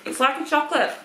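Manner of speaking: speaking between bites; talking while chewing. Real, not acted. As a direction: mouth partly full of biscuit. Seen 1: Woman holds biscuit, bites it at 0.8, chews while talking.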